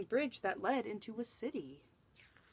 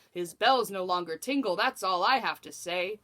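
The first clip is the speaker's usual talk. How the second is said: louder, a blustering royal proclamation; king yelling in dismay; 9 dB louder than usual. Acted. The angry king dismissing his jester, yelled out loud. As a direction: bark it out like an offended monarch. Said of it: "the king yelled in dismay".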